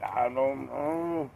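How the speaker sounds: drawn-out, spaced-out reply; slow, dazed. Retold or imitated. Answering her, slow and drawn out. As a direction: stretch it out, half out of it. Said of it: "I'm like".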